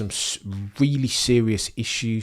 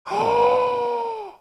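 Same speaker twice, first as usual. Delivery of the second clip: shocked gasp; 4 dB louder than usual. Real, not acted. Shock at crash replay.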